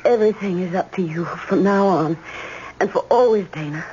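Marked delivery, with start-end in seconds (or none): 3.5-3.8 soft, slow murmur of love; tender whisper. Acted. Laura softly declaring love to Dana. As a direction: breathe it to him.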